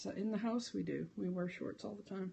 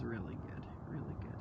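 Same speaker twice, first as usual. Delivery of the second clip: whispered; 8 semitones lower, 7 dB quieter than usual. Real, not acted. Recommending, drops to whisper.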